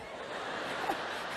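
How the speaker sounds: breathless mock laughing; acted fit of laughter; imitated girlfriend laugh. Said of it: "My girl's laughing".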